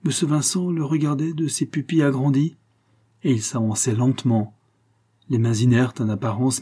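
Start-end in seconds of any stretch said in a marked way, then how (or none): none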